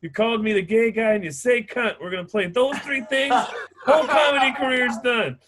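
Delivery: high, loud taunting voice, laughing. Retold or imitated. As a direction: gloat like you're about to cancel him.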